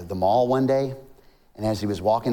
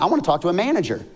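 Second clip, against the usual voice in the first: hurried, higher-pitched complaint; frustrated customer; 7 semitones higher, much faster than usual. Acted. Frustrated man asks for the manager, hurried. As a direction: demand it quickly, a touch frustrated. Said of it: "he gets a little frustrated".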